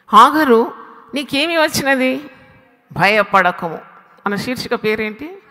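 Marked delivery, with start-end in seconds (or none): 0.1-3.8 raised, loud proclamation as an angel; angel calling. Acted. Angel calls Hagar from heaven, loud proclaiming voice. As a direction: call out to her as heaven's messenger. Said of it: "దేవుని దూత ఆకాశం నుండి".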